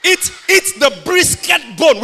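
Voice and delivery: fiery sermon shout; full-force preaching. Real, not acted. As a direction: declare it to the crowd.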